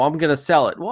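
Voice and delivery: ordinary talk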